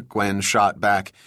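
ordinary delivery, nothing marked

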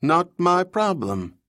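high, slow, pinched dismissal; drawn-out fussy nasal brush-off. Acted. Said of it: "made his voice pinched and nasally".

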